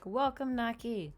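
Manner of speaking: ordinary talk, nothing marked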